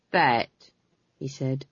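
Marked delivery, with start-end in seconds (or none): 0.1-0.5 low, held-in threat; trembling menace. A narrator's reading. Captain warning three frightened prisoners, low menace. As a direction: start the threat low, on one word. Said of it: "He was trembling".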